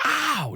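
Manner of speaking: high mock "ow" yelp; retold yelp of pain. Dad imitating his son's bumped head, high mock yelp. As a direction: imitate a kid crying out in pain.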